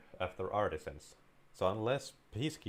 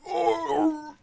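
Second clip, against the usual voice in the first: very high, loud cartoon baddie shrieks; hammed-up villain threat turning to screams; Spyro enemy; 14 dB louder than usual. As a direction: shriek like a burning game enemy. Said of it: "I would make a great Spyro enemy".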